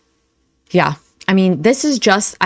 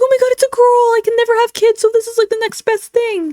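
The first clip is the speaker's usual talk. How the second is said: put-on much higher, gushing baby-talk squeal; mock giddy squeal. Commentator mocking the streamer claiming the kitten as her child, high squeal. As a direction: parody her overjoyed gushing. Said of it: "And she's like".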